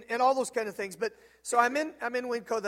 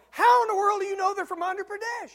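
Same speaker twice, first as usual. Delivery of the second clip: much higher, louder, amazed; can't believe he knows; astonished question; 12 semitones higher, 9 dB louder than usual. Retold or imitated.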